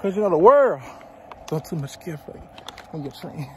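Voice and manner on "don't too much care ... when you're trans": quiet. Real, not acted.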